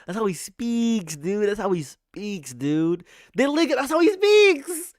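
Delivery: high, stretched-out squealing repeats; giddy insistence. Real, not acted. Streamer insists his Trump impression is accurate, high giddy drawl.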